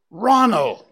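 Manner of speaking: loud sudden call of a name. Acted. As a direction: shout his name across the meadow. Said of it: "called Bambi".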